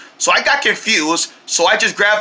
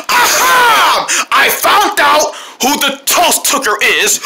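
triumphant catch; 6 semitones higher, much slower than usual. Acted. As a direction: shout like you caught the culprit. Catching the thief.

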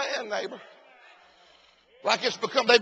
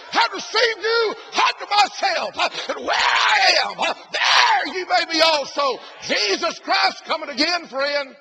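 loud, high revival preaching, shouting to the congregation; preaching at full force; 5 semitones higher, 6 dB louder than usual. Acted. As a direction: preach it hard, higher and louder.

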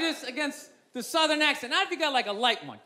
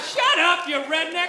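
louder dismissive yell; heckling shout; 4 semitones higher, 5 dB louder, slower than usual. Retold or imitated. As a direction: shout down the speaker. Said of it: "People are going like".

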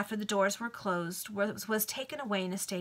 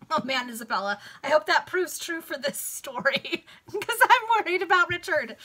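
real laughing aside, higher and louder; amused worry; 6 semitones higher, 6 dB louder than usual. Real, not acted. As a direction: pitch up and laugh through the worry. Reader's amused aside about the story.

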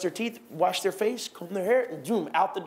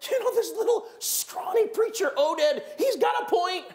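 army leaders; 9 semitones higher than usual. Retold or imitated. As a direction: play the army men urging a turnaround. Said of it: "leadership of the armies of Israel came to and said".

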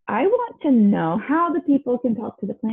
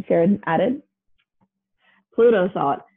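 ordinary delivery

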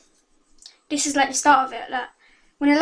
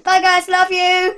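loud, high, slow goodbye; cheery sign-off; 6 semitones higher, 8 dB louder than usual. Real, not acted. Vlogger signing off to viewers, loud and high. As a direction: sing out the goodbye.

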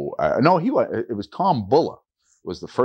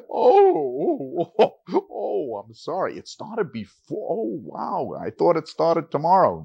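high, flustered and apologetic; flustered surprise; 8 semitones higher, slower than usual. Retold or imitated. Teacher learning on the phone summer school started, flustered. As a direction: catch yourself, dismayed at the mistake.

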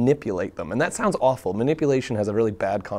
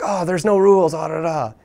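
high, loud carefree mock voice; imitating younger friends, much higher and louder; mocking young people; 7 semitones higher, 7 dB louder than usual. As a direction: toss it off like a careless kid. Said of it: "they're all like".

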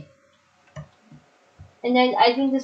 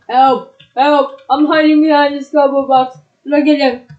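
loud playful cry for help; mock panic; 7 dB louder than usual. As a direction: yell help while hiding. Hiding from a chasing monster.

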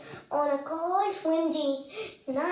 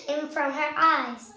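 ordinary delivery

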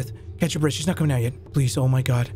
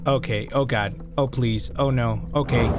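ordinary talk

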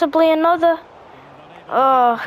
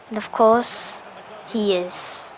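whispered under breath; whispering; 8 semitones lower, 6 dB quieter than usual. Real, not acted. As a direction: drop to a whisper. Trailing aside after remark.

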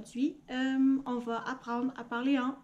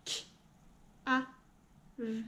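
robot voice; 6 dB quieter than usual. Shown as a demonstration. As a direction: separate sounds one at a time. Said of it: "on le dit en robot". (voix de robot, sons détachés)

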